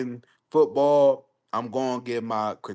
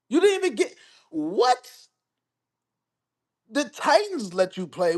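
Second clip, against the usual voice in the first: exasperated rant; 8 semitones higher than usual. Retold or imitated. Host firing back at D-Hop over the stats, exasperated. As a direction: rattle it off like you can't believe him. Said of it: "I'm like".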